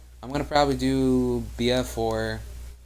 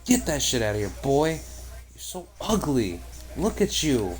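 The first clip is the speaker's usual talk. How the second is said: put-on terrible Australian accent; 3 semitones higher than usual. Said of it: "that was a terrible accent".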